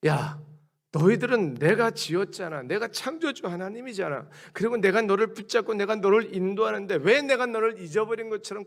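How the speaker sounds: a hint of God scolding his people; God chiding. God reproaching his frightened people, mildly heated. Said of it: "하나님의 이 마음을 아십니까?".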